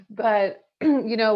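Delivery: usual speaking voice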